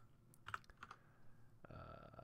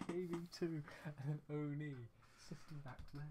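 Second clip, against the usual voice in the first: helpless amusement; 3 semitones higher, 6 dB quieter than usual. Real, not acted. Breaking down after the rant.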